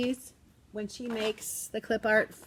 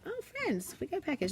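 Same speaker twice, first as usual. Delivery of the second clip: high, singsong teacher announcement; excited to kids; 8 semitones higher, 6 dB quieter than usual. Retold or imitated. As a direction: tell the class there's a surprise. Announces package to the class.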